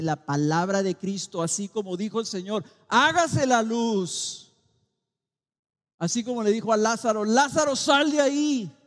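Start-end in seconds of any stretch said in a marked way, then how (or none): 2.9-4.5 mandato divino. Acted. God's command quoted. 7.2-8.8 llamando a Lázaro en voz alta y aguda; llamado fuerte. Acted. Jesus calls Lazarus out.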